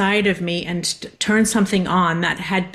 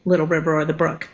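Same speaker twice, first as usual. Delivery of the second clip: normal talking voice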